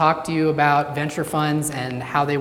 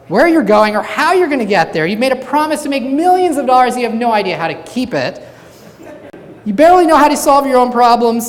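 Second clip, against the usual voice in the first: higher, louder mock scolding lecture; 6 semitones higher, 6 dB louder than usual. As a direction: tear into him point by point. Lady scolds the venture capitalist.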